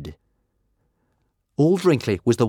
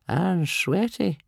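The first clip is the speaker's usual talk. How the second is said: put-on slow, high elderly musing; muttering old man; 11 semitones higher, slower than usual. A narrator's reading. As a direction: ponder it slowly in a high old voice. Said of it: "he muttered to himself".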